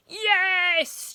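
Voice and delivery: high, loud cheer; crowd cheer. Acted. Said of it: "cheered the crowd".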